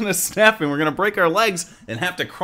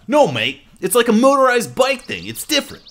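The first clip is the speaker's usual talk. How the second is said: high, insistent Aussie character; put-on Aussie voice climbing high; Australian accent; 9 semitones higher, 4 dB louder than usual. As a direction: correct them in a broad Australian accent.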